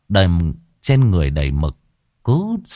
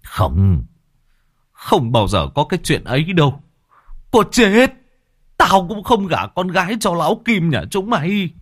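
rising to a much higher defiant cry; defiant through pain; 9 semitones higher than usual. A narrator's reading. Beaten father defiantly shouting at the thugs. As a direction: refuse them with all he has left. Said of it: "Dù đau đớn nhưng ông vẫn nói".

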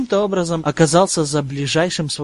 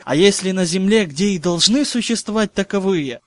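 usual speaking voice

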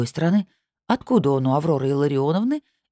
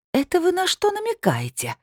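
indignant snarl. A narrator's reading. Woman challenging her rival's jibe about her weight, high indignant snarl. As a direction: snarl back in a high, offended voice. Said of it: "рыкнула та".

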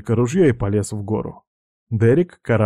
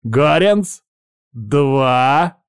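raised, pitched-up warning call; threatening count; 4 semitones higher, 4 dB louder, much slower than usual. Acted. Pirate counting at the man on the mast, threatening call.

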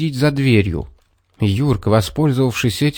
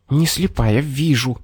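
higher, voiced, mildly irritated fox; irritated hiss; 4 semitones higher than usual. A narrator's reading. Fox answers the nagging bear, mildly irritated hiss. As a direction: brush him off under the breath. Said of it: "прошипела лисица".

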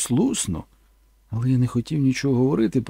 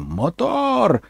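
director calls action; 7 semitones higher than usual. Retold or imitated. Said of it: "Містер Фельдер закричав".

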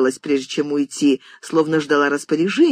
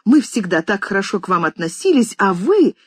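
high and louder, indignant; furious mistress; 6 semitones higher, 4 dB louder than usual. A narrator's reading. Mistress scolding the maids' behaviour, indignant. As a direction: let the outrage spill over.

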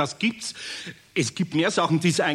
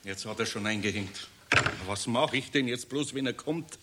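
soft, much quieter words to himself; man alone after the call, worried; worried muttering; 6 semitones lower, 7 dB quieter than usual. Acted. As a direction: mutter it under your breath.

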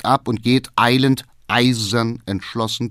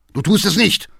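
loud, sharply raised question; outraged challenge; 10 semitones higher, 5 dB louder than usual. A narrator's reading. Father facing his son's refusal, outraged.

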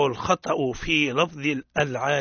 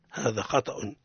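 ordinary talk, nothing marked

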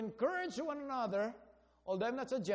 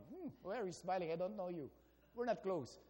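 low, quiet grumble to oneself; mock standoffish, under the breath; aloof grumble; 5 semitones lower, 8 dB quieter, faster than usual. Acts out frowning driver to stranger.

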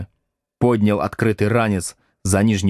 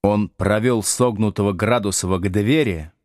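usual speaking voice

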